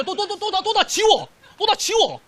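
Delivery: high, loud mock frantic stammer; flustered redo. Teacher mimicking a student erasing botched work, mock flustered. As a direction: act out a panicked test-taker erasing everything.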